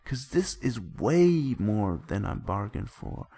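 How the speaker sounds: dramatic yell. Acted. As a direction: yell it like an exasperated storyteller. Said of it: "I yell".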